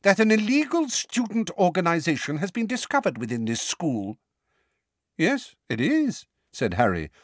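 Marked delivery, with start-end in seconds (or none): 0.0-4.2 higher, tight with anger; thick with anger. A narrator's reading. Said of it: "his voice now thick with anger". 5.2-6.3 higher, slow, mock-innocent; a boy faking innocence to the minister; feigned innocent surprise. A narrator's reading. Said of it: "unconvincing look of innocent surprise".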